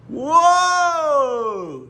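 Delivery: long high cheering wow; delighted drawn-out cheer. Real, not acted. Cheering child's throw.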